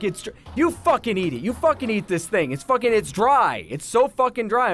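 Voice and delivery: high-pitched ranting customer imitation; mock a customer's irate complaint; retold angry yelling. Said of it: "Please stop yelling".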